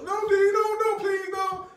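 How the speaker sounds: very high, loud mock wail; imitated panicked begging. Son begging dad not to move the mouse, imitated panic. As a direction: mimic a kid pleading in dread.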